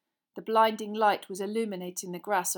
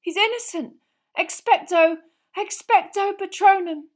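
high, loud urgent protest; desperate cry; 11 semitones higher, 13 dB louder than usual. A narrator's reading. Harry defending Sirius from Dementors, crying out desperately. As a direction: cry it out, then force the spell.

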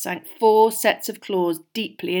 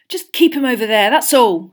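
loud, raised-pitch irritation; irritable; 5 semitones higher, 10 dB louder than usual. A narrator's reading. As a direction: snap it out, annoyed. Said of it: "said Ron irritably".

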